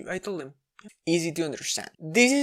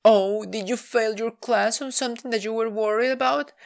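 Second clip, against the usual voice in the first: higher, sweet oblivious mom voice; imitating mom; 7 semitones higher than usual. Mom guessing wrongly, higher and oblivious. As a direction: play the clueless mom guessing. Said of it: "So my mom says".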